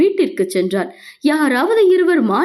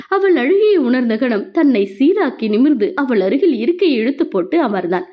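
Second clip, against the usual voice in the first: usual speaking voice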